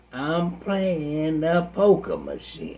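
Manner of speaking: slow, stretched-out casual answer; drawn-out admission. Acted. Churchgoer on the phone to her pastor, drawn-out admission. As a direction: draw the words out unhurriedly. Gambler admits where she is.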